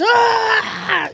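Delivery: high-pitched drawn-out mock scream; acted scream. Narrator acts out her cry as little zombies run in, mock scream. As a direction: let out a high retold shriek. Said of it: "and she goes".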